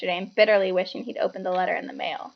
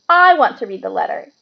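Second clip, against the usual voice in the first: put-on louder demanding boy; loudly; 8 dB louder than usual. A narrator's reading. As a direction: demand it at full volume. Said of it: "he said loudly".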